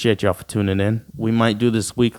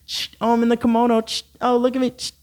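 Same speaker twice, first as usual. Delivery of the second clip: mock smug model; 11 semitones higher, faster than usual.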